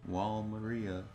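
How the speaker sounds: normal talking voice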